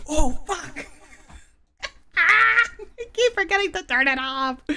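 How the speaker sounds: loud embarrassed laugh. Real, not acted. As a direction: burst out laughing at yourself. Caught with voice effect on.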